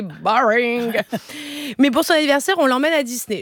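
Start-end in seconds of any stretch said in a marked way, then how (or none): 0.0-0.9 slow, drawn-out English jibe; bored put-down. Acted. Mother mocking her serious little son, bored. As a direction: stretch the word out dismissively.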